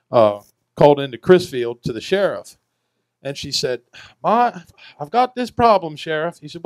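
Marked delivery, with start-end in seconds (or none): 4.2-6.4 put-on higher, fussy old lady on the phone; lightly voiced old island woman, complaining; old woman's voice. Old woman calling the sheriff.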